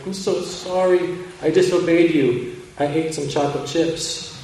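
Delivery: put-on high, pleading child's apology; a little girl owning up, near tears; tearful cry. Emma confessing to Mommy with a high tearful cry. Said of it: "I cried".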